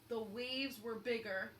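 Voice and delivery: ordinary delivery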